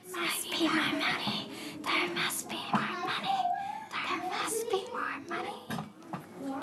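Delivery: ghostly unvoiced whisper, fading. The house whispering, then the voices go mad. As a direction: let the walls whisper it. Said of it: "Then something very curious happened".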